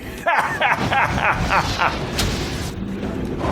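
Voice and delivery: breathy hammy villain cackle; evil cackle. Pretend. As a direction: laugh like a mad sorcerer. Villain laugh.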